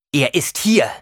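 loud, high insistence; anguished declaration. A narrator's reading. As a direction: declare it forcefully to her. Anguished revelation to mother.

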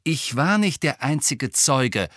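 indignant narrated outburst; angry snarl. A narrator's reading. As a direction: snap back angrily, in character. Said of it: "fauchte Harry".

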